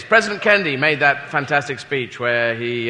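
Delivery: normal talking voice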